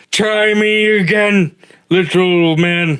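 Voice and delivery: slow booming big-guy voice; dopey cartoon bellow. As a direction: boom it out slowly, teasing. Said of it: "a booming voice go".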